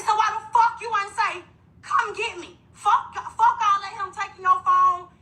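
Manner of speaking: high, tearful furious questioning; mother crying and shouting at her daughter; crying anger. Real, not acted. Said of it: "So I instantly went up crying".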